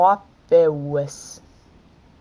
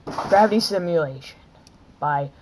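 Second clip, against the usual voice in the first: usual speaking voice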